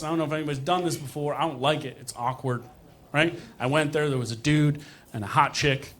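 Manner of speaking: usual speaking voice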